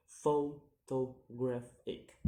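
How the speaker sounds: "photographic" slowly and loudly split into syllables; slow, separated syllables on "photographic". Shown as a demonstration. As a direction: sound out "photographic" piece by piece. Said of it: "count the word syllable backwards".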